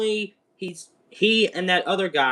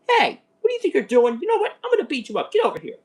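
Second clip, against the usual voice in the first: Godzilla voice; 10 semitones higher, much faster than usual. Retold or imitated. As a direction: squeak out a cartoon monster's challenge. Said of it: "and then Godzilla's like".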